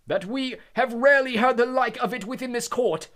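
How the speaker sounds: loud, high stern judge. Acted. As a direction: drown out the son's voice. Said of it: "speaking more loudly".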